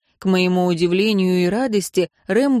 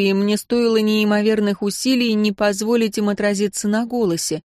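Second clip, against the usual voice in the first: usual speaking voice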